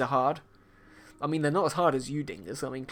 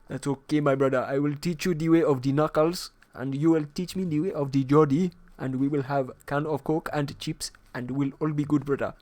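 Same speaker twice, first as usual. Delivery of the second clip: put-on playful African-accented meme voice; hammed-up mock African accent. Meme character voice with foreign accent.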